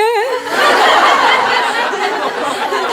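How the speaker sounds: high laugh; real burst of laughter; laughing at the mayfly punchline. Real, not acted. Laughs at own joke.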